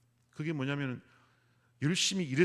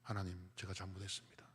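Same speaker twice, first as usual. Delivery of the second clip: softer, low, broken confession; a hushed plea for forgiveness; repentant plea; 5 semitones lower, 11 dB quieter than usual. Retold or imitated. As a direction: whisper it like a penitent on her knees. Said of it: "회개가 터져 나오더라고요".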